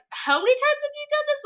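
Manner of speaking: high, quick acted voice; comic high-pitched disbelief; incredulous question. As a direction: ask it fast and way up high. Character's question in comic retelling.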